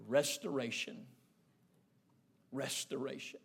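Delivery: very low, soft and weighty; hushed solemn word. Retold or imitated. Granddaddy answering his grandson, hushed solemn resolve. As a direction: let the word fall softly, almost fading. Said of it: "he spoke one word".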